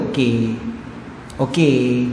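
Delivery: low, drawn-out reluctant okay; mock sulky wife saying okay; not-okay okay. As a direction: say yes while meaning no. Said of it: "Okey tu tak okey lah tu".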